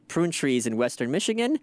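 usual speaking voice

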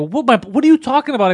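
high, loud indignant protest; furious. Retold or imitated. Furious patient challenging the doctor about blood pressure, indignant. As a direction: protest it in angry disbelief. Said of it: "now I'm furious".

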